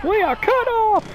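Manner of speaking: high-pitched mock panic cry; mock alarm. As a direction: call it out high, hammed-up. Echoing game's dramatic radio line.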